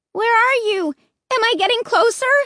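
high, loud, fast call. Acted. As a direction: call out searching through the maze. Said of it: "I called".

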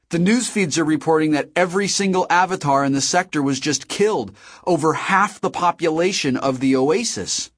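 high-pitched, animated astonishment; amazed report. A narrator's reading. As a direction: relay staggering news to a friend. H reports mass deaths, astonished.

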